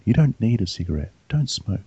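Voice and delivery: hushed, insistent tape message; imitated whispering. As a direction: softly slip the words into a sleeper's ear. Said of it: "starts whispering to you".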